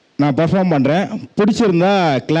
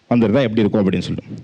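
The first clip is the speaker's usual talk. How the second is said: ordinary talk, nothing marked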